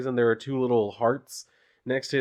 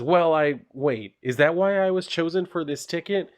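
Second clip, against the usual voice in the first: dawning suspicion; 7 semitones higher than usual. Acted. Doyle realising, voiced.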